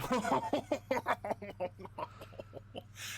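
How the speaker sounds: high snorting half French cackle; evil villain laugh. Shown as a demonstration. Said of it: "Uh, my evil laugh".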